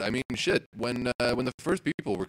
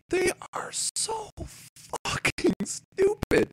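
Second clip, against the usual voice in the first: much higher, slow and drawn out; disgusted rant; slower than usual. Real, not acted. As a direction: stretch every word high in disbelief. Ranting about the Liberals' pot policy.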